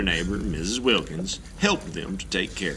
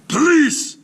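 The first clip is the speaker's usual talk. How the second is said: very loud, high panicked yell; shouting; 13 semitones higher, 10 dB louder than usual. Acted. Mister Klug shouts for the police, loud and panicked. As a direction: yell for help in alarm. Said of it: "shouted Mister Klug".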